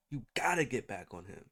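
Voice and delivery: ordinary delivery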